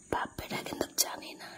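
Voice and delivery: secret whisper while hiding inside. Real, not acted. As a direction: whisper so father and son don't know. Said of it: "আস্তে আস্তে বলতেছি শুনতে পাবে".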